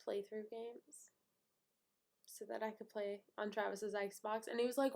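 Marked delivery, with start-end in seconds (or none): none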